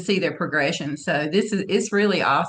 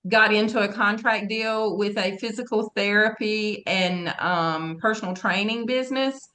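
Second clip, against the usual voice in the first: normal talking voice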